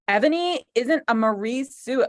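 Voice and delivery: ordinary talk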